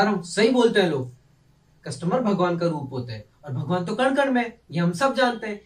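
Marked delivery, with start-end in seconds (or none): none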